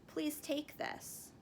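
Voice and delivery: soft, slow and kind; quiet, giving voice; gentle offering. Acted. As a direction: offer it tenderly. Uncle offers only robe.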